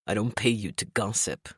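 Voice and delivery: low, curt barked order; gruff bark. A narrator's reading. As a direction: bark it down the hall. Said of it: "he barked down the hall".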